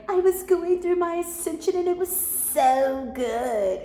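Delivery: high-pitched send-up with heavy emphasis; mocking over-emphatic imitation. Reader retells someone mocking how they talk, exaggerated high imitation. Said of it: "she put so much emphasis on it".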